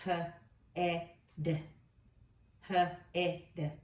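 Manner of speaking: h, e, d said sound by sound; each sound of head apart; segmented sounds. Shown as a demonstration. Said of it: "The next one is".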